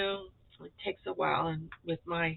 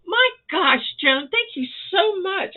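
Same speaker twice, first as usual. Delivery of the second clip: much higher and louder, gushing gratitude; thrilled thanks; 11 semitones higher, 7 dB louder than usual. Real, not acted. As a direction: burst out thanking her, overjoyed.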